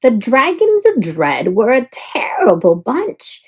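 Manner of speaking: ordinary delivery, nothing marked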